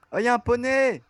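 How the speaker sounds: high, loud cry of delight; excited shout. Real, not acted. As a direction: call out a thrilling discovery. Spots a pony in game. (cri excité, très aigu)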